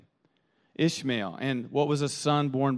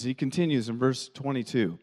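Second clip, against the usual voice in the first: usual speaking voice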